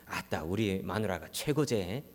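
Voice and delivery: low, soft, slow Jeolla husband praising his wife; Jeolla dialect husband. Retold or imitated. 아내에게 답하는 전라도 남편, 낮고 느린 사투리. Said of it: "이 전라도 남편은 이렇게 얘기합니다".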